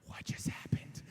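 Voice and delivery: hushed whisper of disbelief; stunned whisper. Retold or imitated. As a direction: whisper it, as if awestruck. Stunned campers react.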